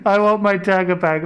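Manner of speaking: frustrated shout. Real, not acted. Repeats demand, louder.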